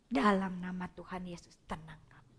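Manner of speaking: soft, low voiced prayer; quiet spoken prayer. Shown as a demonstration. Counselor repeating the calming prayer softly, now voiced. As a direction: murmur the calming prayer gently. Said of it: "saya biasa pakai doa".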